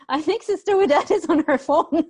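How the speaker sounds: teacher laughing at a typo. Real, not acted. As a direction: giggle while teasing the student. Laughing at autocorrect answer.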